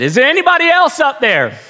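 high-pitched comic punchline plea; hammed-up hopeful shout upward; calling up for help. Punchline, dangling man calls up.

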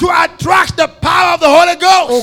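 loud, high preacher's shout; preaching. Real, not acted. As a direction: fire up the congregation. Preaching at full force.

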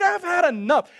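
high, loud mock exasperation; fed-up Martha. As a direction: act out someone at the end of their rope. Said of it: "and she's tired".

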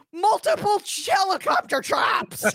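high-pitched comic shout; absurd announcement. Pretend. Imagining a subtitle for her cleansing breath, silly shout. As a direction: blurt it out in a high, silly yell.

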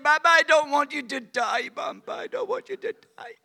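put-on high, tearful little girl pleading; high child's sob, half-imitated; child sobbing. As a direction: voice a crying five-year-old begging. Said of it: "She starts sobbing".

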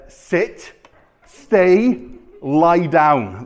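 short, separate commands with pauses; spaced-out obedience commands; firm command. Acted. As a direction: order them like training a dog. Jesus commands him like a dog.

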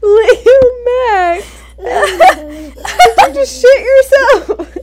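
squealing, laughing through the words; can't stop laughing; laughing hysterically. Real, not acted.

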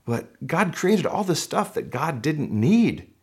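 ordinary talk

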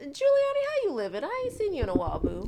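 airy, high, breathy greeting; windy voice. Pretend. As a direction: breathe it out like a gust of wind. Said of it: "In my windy voice".

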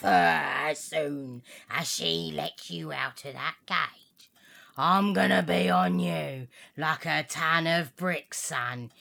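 low, menacing dog threat; dog sizing up the parrot. Acted.

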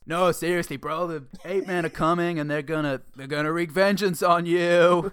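urgent beach bro; surfer dude voice. Acted. Surfer hero pleading.

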